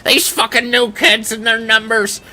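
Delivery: put-on high-pitched cranky old-timer voice; old get-off-my-lawn guy. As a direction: complain like an old man yelling at kids. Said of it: "like an old get off my fucking lawn guy".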